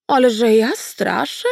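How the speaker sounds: lightly voiced old she-wolf, slow and innocent; innocent wolf goddess. A narrator's reading. Old wolf goddess plays innocent to her granddaughter. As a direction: feign innocence, slow and sweet. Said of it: "z miną niewiniątka".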